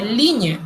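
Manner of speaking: "ligne" with an ñ sound for GN; French pronunciation. Shown as a demonstration. Teacher showing how GN makes ñ, French pronunciation. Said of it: "en francés se dice".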